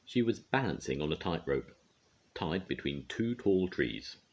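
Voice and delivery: ordinary delivery, nothing marked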